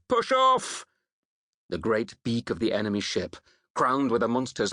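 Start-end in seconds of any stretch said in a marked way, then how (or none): 0.1-0.9 high, far-carrying yell; called-out command. A narrator's reading. Unknown voice calling to the crew to cast off, shouted. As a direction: shout an order across the water. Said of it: "A voice called".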